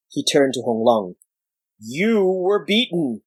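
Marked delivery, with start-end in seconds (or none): 1.8-3.2 rumbling elder. Acted. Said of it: "he rumbled".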